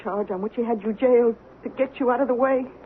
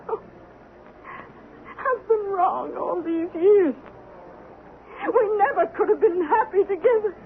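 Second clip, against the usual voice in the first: tearful and breaking, voice pitched up; crying; 7 semitones higher than usual. Acted. Esther breaks down in tears after Ben's cruelty, crying. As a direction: sob the words out as the regret hits her.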